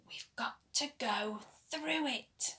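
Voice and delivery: hushed whispered line. A narrator's reading. Storyteller to children, in a whisper. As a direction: breathe it secretly.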